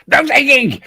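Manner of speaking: high-pitched imitation telling-off; mock scolding. Moderator turning on bus passengers, high mock scolding. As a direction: play an overzealous moderator pouncing on an offender.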